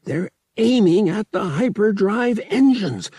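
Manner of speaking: high, loud alarm; urgent realization. Acted. Under fire.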